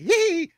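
very high yelp of a little dog; high dog yip. Pretend. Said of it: "not barking like".

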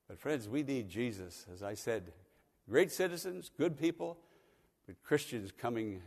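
ordinary delivery

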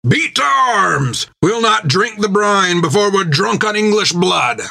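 high, slow rallying battle cry; captain's shout. A narrator's reading. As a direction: rouse the crew to fight. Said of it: "Jack shouted".

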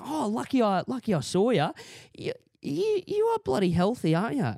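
high, fussy landlady voice with Aussie lilt; gossipy neighbour calling out; chatty older landlady. Retold or imitated. Voicing old landlord.

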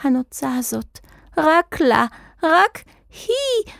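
starts contained, climbs to a high drawn-out cry; building fervour. A narrator's reading. Wizard obsessing over the feather, fervour mounting to a cry. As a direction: rise in pitch with each phrase to a wild peak.

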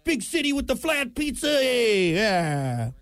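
high, hammed-up New York accent. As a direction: lay on a brash mock New Yorker. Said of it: "The New York accent".

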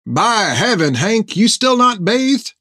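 lightly voiced old sailor, teasing; amused ship's mate, slow and dry; gruff sailor. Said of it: "said Topper".

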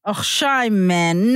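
"Oh, shame, man" with a guttural och and long shame in a South African accent; South African accent, rueful sigh. Shown as a demonstration. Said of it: "you have to say".

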